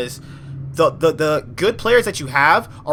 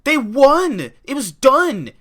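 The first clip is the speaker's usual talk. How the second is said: exasperated; 10 semitones higher than usual. Real, not acted. Frustrated recounting loss.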